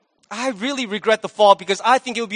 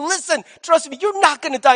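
higher-pitched acted insistence; emphatic; 6 semitones higher than usual. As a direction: press the false promise urgently, pitch raised. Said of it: "he's emphatic".

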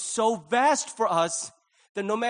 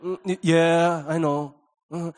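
put-on bored teenager; 2 semitones lower, 7 dB louder than usual. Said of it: "get a little older and you go".

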